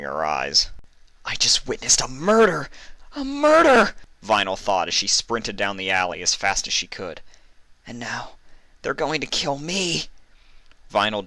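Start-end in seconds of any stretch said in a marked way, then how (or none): 1.2-3.9 high, loud panic; frantic voice rising to a scream; panicked. Acted. Panicked inner thought. 7.9-10.1 frightened. Acted. Panicked inner thought.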